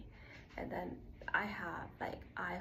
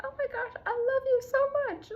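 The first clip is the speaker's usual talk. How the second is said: much higher, louder, squealing with affection; gushing to herself; 10 dB louder, faster than usual. Retold or imitated. Telling herself she loves her, gushing very high. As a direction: gush like meeting your best friend. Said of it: "I'm just like".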